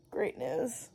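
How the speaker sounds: ordinary talk, nothing marked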